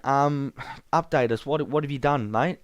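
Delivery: ordinary talk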